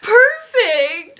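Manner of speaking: high, loud gushing imitation; Sabrina. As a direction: draw it out, mimicking an insistent friend. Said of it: "she was like".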